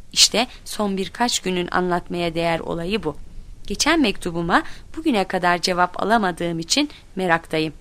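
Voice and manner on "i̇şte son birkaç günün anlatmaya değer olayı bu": ordinary delivery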